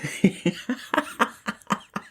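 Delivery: long run of giggly laughter; cracking up. Real, not acted. Host starting on Ellie's announcement, cracking up. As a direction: burst out laughing mid-story.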